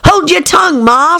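put-on high, snippy young crab; a touch of a snappy daughter; snappish young crab. A narrator's reading. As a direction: talk back to mother crab. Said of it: "a little snappishly".

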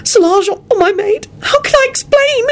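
very high anguished wail. A narrator's reading. Elizabeth fears her maid finding them, anguished wail. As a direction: let panic and anguish pitch it up. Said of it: "in an anguished voice".

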